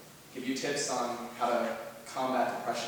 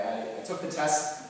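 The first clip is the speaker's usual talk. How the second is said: ordinary talk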